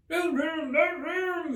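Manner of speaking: high, loud shrill-free cry of the crowded tea party. A narrator's reading. The Hatter and Hare shouting Alice away, high and loud. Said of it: "they cried".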